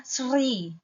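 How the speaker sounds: SRI with a laboured, drawn-out S-R onset; struggled SR cluster. Shown as a demonstration. Teacher admitting her weak R, struggling through SRI. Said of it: "aku sulit nyebutnya".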